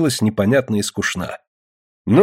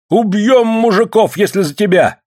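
women's threatening shout; 11 semitones higher, 4 dB louder than usual. A narrator's reading. Village women threaten over the vote, shouting. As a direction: cry the threat out high. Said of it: "закричали бабы".

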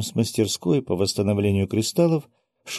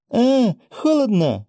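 very high, drawn-out wail; yelp of cold; 5 dB louder, slower than usual. A narrator's reading. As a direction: howl as snow hits the skin. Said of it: "завопил японец". (вопль от холода)

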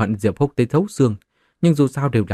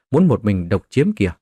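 ordinary delivery, nothing marked

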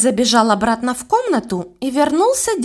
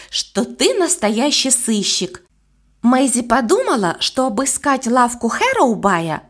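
plain reading voice

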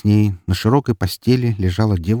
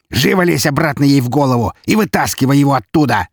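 loud, high, angry command; forceful, enraged demand; furious order; 6 semitones higher, 5 dB louder than usual. A narrator's reading. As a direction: shout the order in a rage. Enraged count giving orders.

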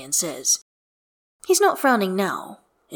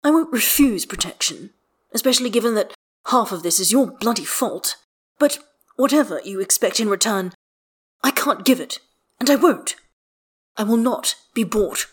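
mildly heated, raised-pitch refusal; indignant; 4 semitones higher, 5 dB louder than usual. Acted. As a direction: dig in and refuse, offended. Acted quarrel, refusing to be bought.

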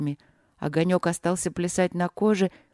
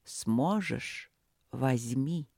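quiet; 5 semitones lower, 6 dB quieter, much slower than usual. Acted. Voice tests again.

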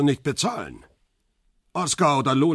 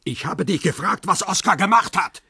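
angry demand; 6 semitones higher, 3 dB louder than usual. Acted. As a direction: voice rising to a loud shout. Interrogator loses patience, repeats question.

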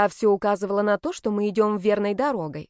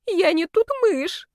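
high, slow, drawn-out alarm; dismayed, high-pitched wail; panicked cry; 10 semitones higher, 4 dB louder, slower than usual. A narrator's reading. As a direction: cry out for help at a sudden fright. Heroine panics at a mouse.

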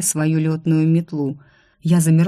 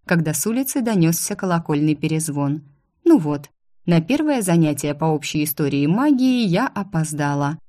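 usual speaking voice

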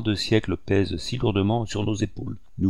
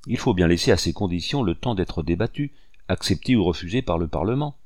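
usual speaking voice

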